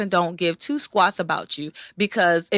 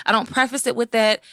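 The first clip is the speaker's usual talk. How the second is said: normal talking voice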